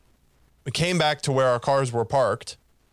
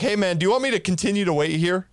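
calling out; 6 semitones higher, faster than usual. Retold or imitated. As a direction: shout a breezy offer through the car window. Retold call from the backing car.

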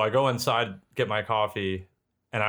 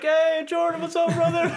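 much higher, chummy greeting; cheery old dad; 4 dB louder, slower than usual. Retold or imitated. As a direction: greet him like a happy retiree. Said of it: "he's like".

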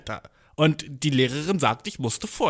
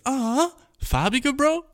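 much higher and slow, stretched "Farbige"; mock disbelief; 11 semitones higher, much slower than usual. Mocking the boy's word choice, slow high disbelief. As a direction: drag the word out as if it's absurd.

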